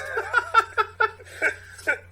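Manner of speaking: high, loud cracking laugh; high burst of laughter. Real, not acted. Reader cracking up mid-script, in high loud laughter. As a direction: break up laughing.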